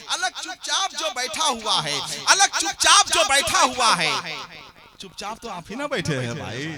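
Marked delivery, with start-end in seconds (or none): 0.1-4.1 ringing recitation, much louder; loud poetic declamation. Acted. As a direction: proclaim each line to the hall. Repeat rising back to loud. (ज़ोरदार अंदाज़ में शेर पढ़ना)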